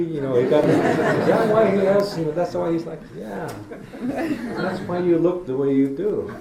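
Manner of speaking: high, laughing through the words. Real, not acted. Teasing Curtis about his looks, laughing high. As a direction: crack up while teasing.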